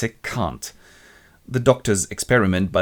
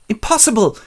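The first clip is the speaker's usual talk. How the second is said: much higher, louder denial; high outburst; 13 semitones higher, 6 dB louder than usual. Retold or imitated. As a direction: burst out. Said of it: "And he goes".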